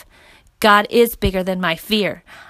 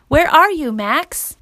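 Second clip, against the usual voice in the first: lightly voiced mother, higher and louder; worried mother; 7 semitones higher, 4 dB louder, slower than usual. A narrator's reading. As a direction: call out as a concerned mom. Mother on phone seeking her son.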